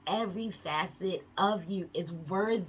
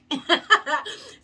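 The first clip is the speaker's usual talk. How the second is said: sudden high laugh; loud real laugh; burst of laughter; 10 semitones higher, 9 dB louder than usual. Real, not acted. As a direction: let a delighted laugh burst out.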